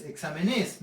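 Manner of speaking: "exámenes" misstressed as exame-NÉS; "exámenes" rising onto a stressed "nes"; wrong stress on NES. Shown as a demonstration. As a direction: land on the last syllable of "exámenes". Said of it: "no, exámenes".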